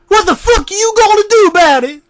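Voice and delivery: high, loud screaming rage; furious scream. A narrator's reading. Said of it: "Jack screamed at the screen".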